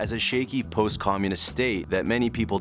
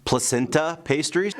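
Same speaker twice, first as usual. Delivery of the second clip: ordinary talk, nothing marked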